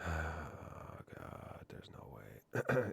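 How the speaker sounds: slow, low, near-whispered groan; hushed disbelief. Real, not acted. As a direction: sigh it out softly, stunned. Stunned by discovery.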